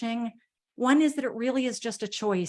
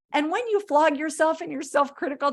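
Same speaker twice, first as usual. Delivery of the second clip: normal talking voice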